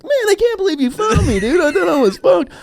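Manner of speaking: put-on high, overjoyed drunk thanks; gushing thanks. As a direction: gush like a saved drunk. Drunk gratitude to cousin.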